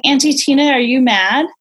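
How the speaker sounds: put-on a four-year-old checking if auntie is mad; lightly voiced worried little girl; little niece. Little niece asking aunt.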